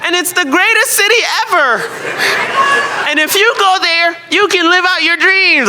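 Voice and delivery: mock her bubbly pride in the city; gushing audience member. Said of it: "she said".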